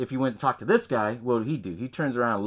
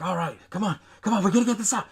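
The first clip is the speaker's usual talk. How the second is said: freaking out; 6 semitones higher, faster than usual. Retold or imitated. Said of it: "that's when he freaks out".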